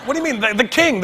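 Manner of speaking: high, stammering insistence; exasperated disbelief. Retold or imitated. Comic insisting to his interrogator, high and exasperated.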